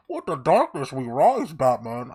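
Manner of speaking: menacing, slow Bane-style delivery. Retold or imitated. Quoting Bane to friends, in a slow menacing voice. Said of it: "I was talking Bane quotes".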